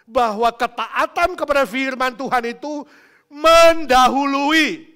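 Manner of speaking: high, loud shouted charges; full-force preaching. Real, not acted. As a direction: thunder out each offence to the congregation.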